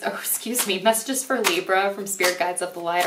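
usual speaking voice

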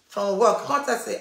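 normal talking voice

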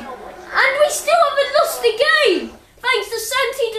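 high-pitched jubilant commentary; triumphant excitement. Real, not acted. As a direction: crow about escaping defeat at the last second. Celebrating a last-second equaliser.

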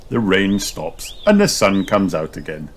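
ordinary delivery

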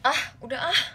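ordinary talk, nothing marked

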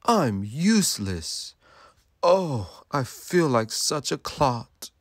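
mildly dejected, drawn-out lament; self-pitying lament. A narrator's reading. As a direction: sigh out the self-reproach, rising on the moan. Giraffe despairs after being jeered.

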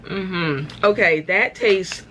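finishing a bite; chewing. Real, not acted. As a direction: mouth partly full. Seen 2: Chip eaten at -9; mouth still working early, talking freely from 2.0.

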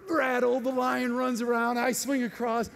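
ordinary talk

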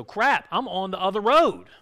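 a sudden oh-no as it dawns on you; alarmed realization. Acted. Churchgoer realizing they took the wrong road: loud dismay. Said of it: "listen to this and go".

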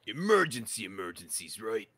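attempted Boston accent, slipping off; a Boston fireman's accent, not quite landing. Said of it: "I went to do a Boston accent".

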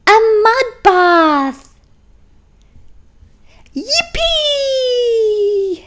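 slow high mud then squealing yippee; the pair cheering at the end; joyful cheer. Acted. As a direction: cheer the happy ending. Story's joyful ending.

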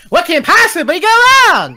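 loud, high, drawn-out catchphrase; said right. Shown as a demonstration. Correcting a friend's catchphrase, loud and drawn out. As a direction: belt it out high, stretching the last words. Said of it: "you gotta say it right".

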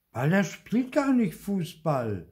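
put-on high, loud, slow indignant child; mild boyish outrage. Theo correcting his father, lightly indignant. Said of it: "sagt Theo entrüstet".

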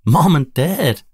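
high, loud joyful call; shouting. A narrator's reading. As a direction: call out to parents at the door. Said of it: "Nilo and Nila shout".

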